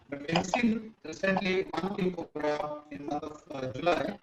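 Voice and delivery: choppy, breaking-up call line; bad connection, audio cutting out; buzzy glitchy call audio. Real, not acted. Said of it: "you're breaking up".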